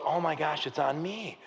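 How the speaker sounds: soft, stunned murmur; daunted realisation. Acted. Gideon realises the burden is his.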